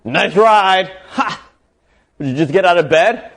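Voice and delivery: high, loud taunt with a scoffing "Ha!"; cocky, arrogant. Acted. Cocky driver jeering at a woman in a minibus, arrogant. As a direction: jeer like an arrogant show-off. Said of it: "being all cocky and arrogant".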